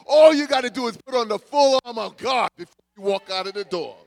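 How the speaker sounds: loud, high, hammering preacher cadence; full-force preaching. Acted. Pastor exhorting the church on Resurrection Sunday, loud and fervent. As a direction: drive each line home like a revival.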